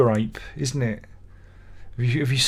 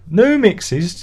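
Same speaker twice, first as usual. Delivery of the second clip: incredulous; 7 semitones higher, 6 dB louder than usual. Real, not acted. Repeats it again, incredulous.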